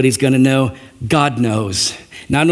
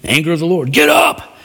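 mock divine anger; 3 semitones higher than usual. Preacher imitating an angry God shouting at Joshua. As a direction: ham up the wrath of the Lord. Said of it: "And he's all mad".

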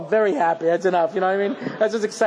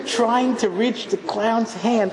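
ordinary delivery

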